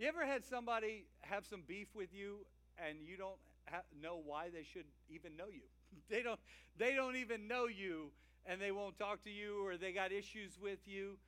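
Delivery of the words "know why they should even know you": ordinary delivery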